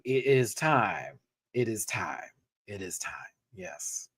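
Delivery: slow, sinking to a near whisper; fading repetition. Pretend. Repeats it, fading away.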